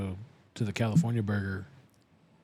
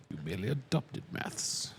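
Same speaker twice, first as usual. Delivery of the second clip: lower, softer villain voice; Bane parody; 4 semitones lower, 7 dB quieter than usual. Acted. Friend parodies the film speech about maths, low Bane impersonation. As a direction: gloat low as a masked villain.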